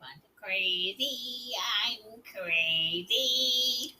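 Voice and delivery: mock crazy murmur; low crazy voice. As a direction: drag it out like a madman. Mom says lines low and slow.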